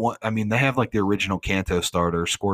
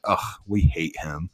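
low, slow mock huff of dislike; huffy disgust; 4 semitones lower, slower than usual. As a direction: groan it out as the exasperated gods, lightly acted. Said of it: "like, huff".

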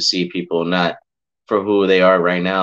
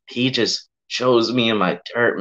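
ordinary talk

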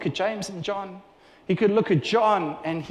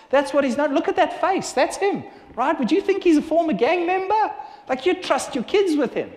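higher, animated disbelief; lively and amazed; 8 semitones higher than usual. Acted. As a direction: marvel at the photo with the audience. Animated about the photo.

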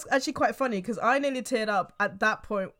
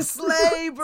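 hyped; 5 semitones higher than usual. Retold or imitated. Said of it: "I was like".

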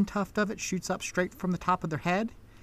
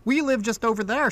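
put-on little girl; 6 semitones higher than usual. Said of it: "the little girl pipes up".

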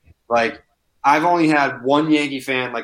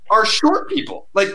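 mocking lecture; 7 semitones higher than usual. Retold lecture to heckler.

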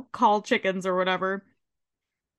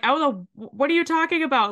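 higher, flustered mock protest; mock panicked denial; 6 semitones higher, much faster than usual. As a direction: play a caught man sputtering denials. Leahy caught off guard, flustered.

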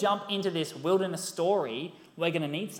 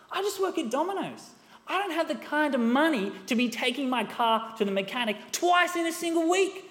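rising, louder exasperated rant at the broken car; frustrated rant; 8 semitones higher, 6 dB louder than usual. Retold or imitated. Preacher retelling his rant at the car, loud and exasperated. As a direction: rant at the car like it betrayed you. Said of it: "And I was like".

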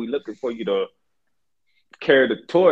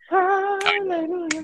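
soaring falsetto praise; high falsetto hallelujah; much slower than usual. Pretend. Praising a hoped-for miracle.

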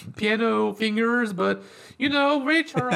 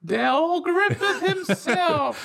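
mock announcer introduction; 4 semitones higher than usual. Podcast co-host grandly introducing John Candy, mock announcer. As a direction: draw out every word like a ring announcer.

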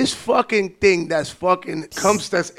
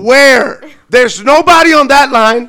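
louder, higher furious shout; angry shout; 7 semitones higher, 12 dB louder, slower than usual. Retold or imitated. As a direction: shout it in frustration. Retelling angry exchange.

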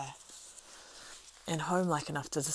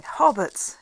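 loud, high excited exclamation; cried out; 7 semitones higher, 12 dB louder than usual. A narrator's reading. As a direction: burst out with it. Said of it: "he cried".

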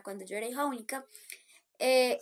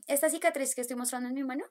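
ordinary talk, nothing marked

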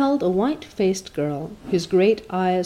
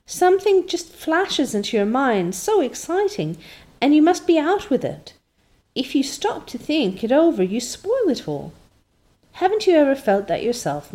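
lightly voiced eager girl, higher; a hint of an excitable girl, pitched up; 7 semitones higher than usual. Anne explaining impulsiveness to Mrs Lynde, eager and higher.

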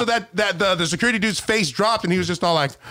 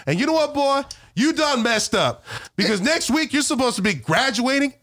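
higher-pitched, emphatic mock lecture; imitating scolding principal; 8 semitones higher than usual. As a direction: let the kid know judgement is coming.

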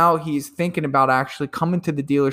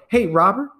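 bright phone greeting; 6 semitones higher, 4 dB louder than usual. Shown as a demonstration. Acted cold call opener.

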